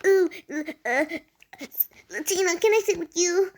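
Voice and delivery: stammer, then ask in a high mocking voice; mock pleading classmate. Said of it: "What's a person who says".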